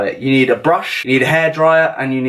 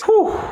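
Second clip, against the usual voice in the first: whoop of delight. Real, not acted. Reacts to the food.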